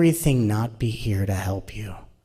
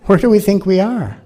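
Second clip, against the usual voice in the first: louder, higher and fast, incredulous; exasperated outburst; 10 semitones higher, 8 dB louder than usual. Real, not acted. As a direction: throw the question at the room in disbelief.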